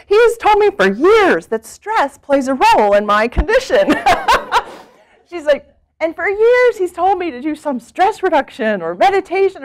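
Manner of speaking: can barely hold the laugh while quoting; retelling a patient's story, laughing; laughing quote. Retold or imitated.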